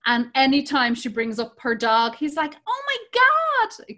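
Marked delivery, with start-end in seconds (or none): 2.6-4.0 put-on son's gushing Canadian accent, pitched way up; mock Canadian kid cooing over a dog. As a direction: imitate a kid's high, swooning Canadian "oh my God". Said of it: "'cause he, he has a Canadian accent".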